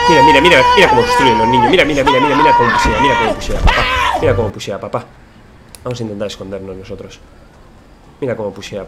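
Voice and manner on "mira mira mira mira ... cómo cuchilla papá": mocking taunt. Mocking kids' building.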